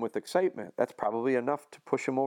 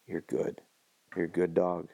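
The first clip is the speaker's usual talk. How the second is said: soothing praise; 6 semitones lower, 3 dB quieter than usual. Retold or imitated. Calming an over-excited dog.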